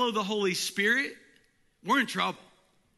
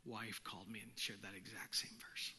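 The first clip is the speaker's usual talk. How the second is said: whispered; 9 semitones lower, 17 dB quieter than usual. Retold or imitated. Quoting grieving friend on phone.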